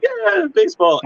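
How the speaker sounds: high, excited little cheer; mock cheer. Kids watching the old videotape, high mock cheer. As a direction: whoop it like a kid at the TV.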